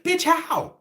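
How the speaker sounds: high-pitched disbelief; incredulous retort. Retold or imitated. Acts out his incredulous retort.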